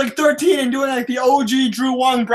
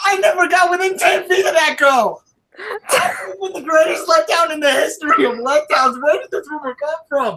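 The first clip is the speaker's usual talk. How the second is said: high-pitched, shouted protest; outraged denial; 7 semitones higher than usual. Real, not acted. Local legend denying a rumor about a girl, outraged. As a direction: cry out in exasperated disbelief.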